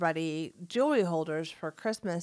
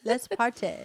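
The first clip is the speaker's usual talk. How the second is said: higher, mock English accent; attempted English accent; 4 semitones higher than usual. Friend voicing Oscar Wilde as party guest, botched English accent. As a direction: make a half-hearted stab at English.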